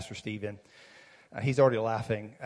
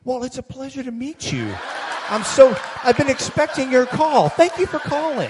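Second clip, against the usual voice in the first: much higher, sweet and proper; polite pastor voice; 13 semitones higher, 8 dB louder than usual. Retold or imitated. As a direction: switch to a charming, welcoming phone voice. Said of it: "then instantly the voice changed".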